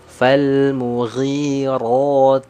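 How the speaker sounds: slow chanted Quran recitation; recited verse. Shown as a demonstration. Teacher chanting the verse slowly for students. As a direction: draw each sound out for the class. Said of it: "models recitation".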